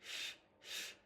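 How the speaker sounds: voiceless hushed sh; whispered শ sound; isolated sh sound. Shown as a demonstration. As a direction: hiss a palatal শ- on its own. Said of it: "শিনটা হচ্ছে তালে ব".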